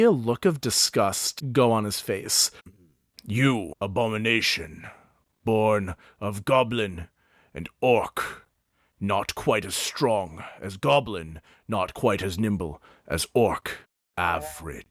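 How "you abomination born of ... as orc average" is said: disdainful orc. Acted. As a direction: sneer down at the half-orc. Mountain insults half-orc.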